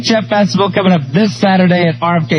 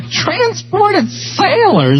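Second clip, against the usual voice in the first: high, laughing repeat; laughing disbelief; 11 semitones higher, much slower than usual. Real, not acted. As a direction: squeal it through laughter.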